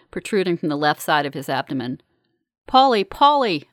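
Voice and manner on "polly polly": slow, higher, louder shout of a name; urgent raised call. A narrator's reading. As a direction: shout to rouse an unconscious friend. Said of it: "I shout".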